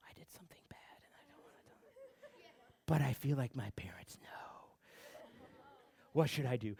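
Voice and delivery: barely audible, whispered worry; hushed guilty thought. Retold or imitated. Child alone in the car with parents, hushed and guilty.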